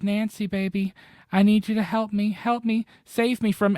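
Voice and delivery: high, loud pleading voice; mock desperate mom pleading; imitating mom. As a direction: beg like a trapped mother. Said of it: "the mom is like".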